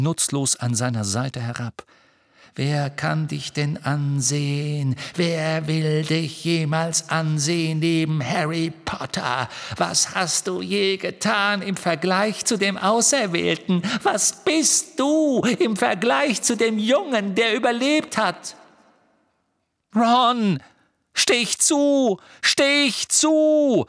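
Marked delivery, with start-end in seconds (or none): 2.6-18.6 higher, eerie sneer building to shrieking; mounting scornful menace; taunting phantom. A narrator's reading. Phantom taunts Ron, rising in fury. 19.9-23.8 high, frantic repeated shout; desperate full-force yell; screaming. A narrator's reading. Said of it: "schrie Harry".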